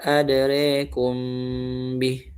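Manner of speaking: adrekum with the a bent toward e, drawn out; imalah reading, slow. Shown as a demonstration. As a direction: recite adrekum slowly with imala.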